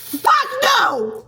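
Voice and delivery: high-pitched, drawn-out holler; shrieked comic refusal, far above normal pitch; emphatic high refusal. Acted. Emphatic refusal to viewer question.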